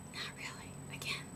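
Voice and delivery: unvoiced whisper, taking it back; breathy little self-correction; whispering her aside to the viewers. Real, not acted.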